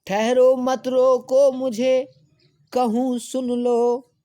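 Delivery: loud, slow, high demand; forceful queen's cry; commanding recitation. Acted. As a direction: stop them all and make them listen. Kaikeyi demands to be heard.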